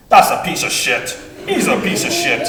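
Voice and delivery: scornful, rising into a high laughing voice; Uncle Mike dismissal. Retold or imitated. Nephew imitating uncle dismissing someone, scornful then laughing. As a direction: sneer it, then crack up high. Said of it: "he would go".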